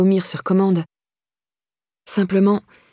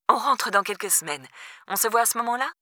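soft, higher-pitched motherly phone voice; breezy mother on phone; 4 semitones higher, 7 dB quieter than usual. A narrator's reading. Mother abroad putting off her daughter's visit, breezy and soft. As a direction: keep it small and high, casually putting her off.

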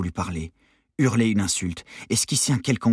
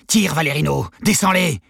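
high, forceful yelled command; urgent shout; 9 semitones higher, 8 dB louder than usual. A narrator's reading. Struggling detainee urges accomplice to shoot guards, shouted. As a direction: shout him into action, higher and harder. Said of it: "cria Novakovski".

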